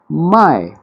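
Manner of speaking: माय sharp, high and loud, falling hard; माय snapped out with a jolt, falling tone; fourth tone mai. Shown as a demonstration. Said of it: "एक झटके से बोली गई हो".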